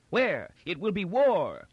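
high-pitched warlike outburst; outraged old Emperor. A narrator's reading. Emperor hears Gilmer is alive, fired up for war. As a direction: demand it imperiously, voice shooting up. Emperor thundering at rebel news.

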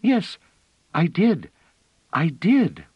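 put-on high, loud eager little girl; little girl bursting with pride; proud excited child. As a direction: insist proudly like a small child.

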